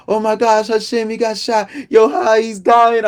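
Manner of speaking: high-pitched imitation of alarmed girls; panicking girls. Girls at the shooting scene react, imitated panic. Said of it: "all the girls and they're like".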